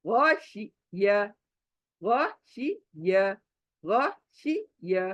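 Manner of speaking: गौशिया drawn out slowly, each syllable held; teacher spelling out गौशिया slowly for students; slow drill. Shown as a demonstration. Slow pronunciation drill. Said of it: "फिर speed बढ़ा दो".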